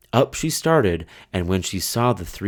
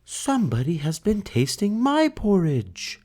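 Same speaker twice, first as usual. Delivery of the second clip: higher mother bear voice; middle-sized bear voice; 12 semitones higher than usual. Acted. Mother bear noticing her porridge tasted, higher voice. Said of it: "said in her middle-sized voice".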